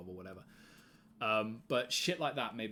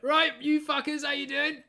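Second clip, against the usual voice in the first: much higher and louder cheeky yell; brash greeting; 10 dB louder than usual. Retold or imitated. Nine-year-old greeting posh American kids, mock brash yell. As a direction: bellow the greeting like a cocky kid. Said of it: "just be like".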